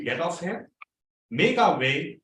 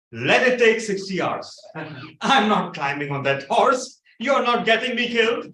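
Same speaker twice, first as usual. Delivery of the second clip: louder, higher defiant shout; loud defiant refusal; 6 semitones higher, 4 dB louder than usual. Retold or imitated. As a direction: plant your feet and shout it down. Shouting at the groom.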